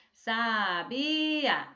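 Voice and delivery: Sabia in drawn-out separate syllables, sa-bi-a. Shown as a demonstration. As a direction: wrongly chop "Sabia" into slow syllables.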